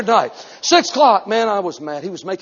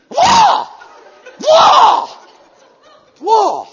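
very high, loud whoa shouts; kung fu cries; 12 dB louder, much slower than usual. Pretend. Preacher acting out fighting moves, high loud cries. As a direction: yelp like a karate fighter. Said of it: "I felt Kung Fu come on me".

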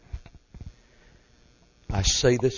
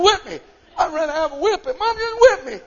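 laughing plea; 6 dB louder than usual. Retold or imitated. Boy begging, laughing.

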